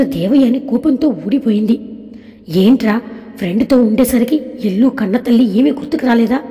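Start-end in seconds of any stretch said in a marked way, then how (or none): none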